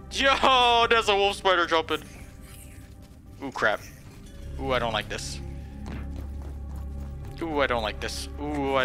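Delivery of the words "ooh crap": ordinary talk, nothing marked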